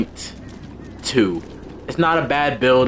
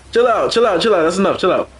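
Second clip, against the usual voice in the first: excited pleading; 6 semitones higher than usual. Real, not acted. Telling players to stop.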